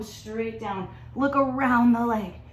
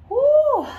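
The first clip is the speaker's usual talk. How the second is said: loud high woo; celebratory whoop; 11 dB louder than usual. Real, not acted. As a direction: cheer the finish. Celebrating end of workout.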